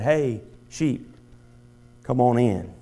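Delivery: calm, easy call, barely lifted; just call them gently, no strain. Retold or imitated. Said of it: "raised his voice only slightly".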